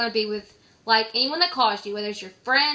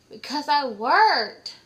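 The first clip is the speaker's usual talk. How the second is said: slow tired reply; 3 semitones higher, 4 dB louder, much slower than usual. Retold or imitated. Night worker answering the pesky caller, slow and weary. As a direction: drag the words out, slow and weary.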